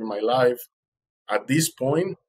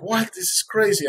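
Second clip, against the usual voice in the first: high-pitched retold disbelief; astonished; 9 semitones higher than usual. Swimmer learning his rescuer is a neighbour, astonished. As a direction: jump up in pitch, stunned.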